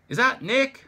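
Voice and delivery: loud, high shout down a phone line; shouting back. Acted. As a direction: yell over the din. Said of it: "I shout back".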